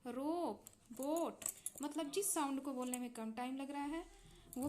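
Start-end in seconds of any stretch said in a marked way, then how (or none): 0.1-1.4 "रोप, बोट" with a long, held ओ; long vowel demo. Shown as a demonstration. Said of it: "long sound क्या है".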